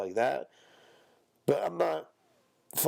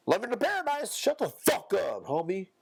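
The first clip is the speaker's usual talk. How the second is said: mocking then snapping; 9 semitones higher than usual. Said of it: "mocking phrase then retort".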